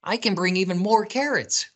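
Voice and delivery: high, eager rabbit; gleeful high storybook rabbit; smiling delight. A narrator's reading. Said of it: "Rabbit said with a smile".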